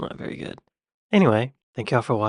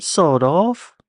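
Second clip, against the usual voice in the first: higher-pitched mock British accent; imitation British swearing, pitched up; 5 semitones higher, 4 dB louder than usual. Shown as a demonstration. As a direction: put on a British accent for the insult. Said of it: "say it with a British accent".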